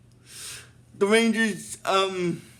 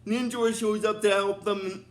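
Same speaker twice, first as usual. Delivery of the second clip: usual speaking voice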